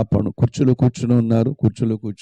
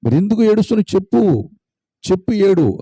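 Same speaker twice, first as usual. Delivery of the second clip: insistent father; 9 semitones higher than usual. Acted. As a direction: insist he tell you now. Father demands the reason.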